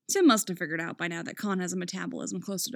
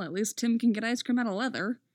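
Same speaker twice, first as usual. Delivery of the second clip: ordinary delivery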